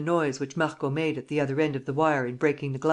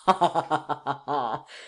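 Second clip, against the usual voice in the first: shouting with laughter; a loud, gloating laugh. A narrator's reading. Lupin laughing at Kesselbach's slip, loud gloating laugh. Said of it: "shouting with laughter".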